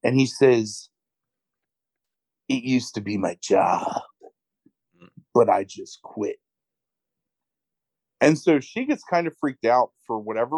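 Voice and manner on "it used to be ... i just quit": mock-brooding actor delivery with heavy pauses; awkward indie acting. Host mimicking the actor's confession to his date, awkward indie acting. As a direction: imitate a self-serious indie actor, halting. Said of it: "awkward-ass indie James Franco acting".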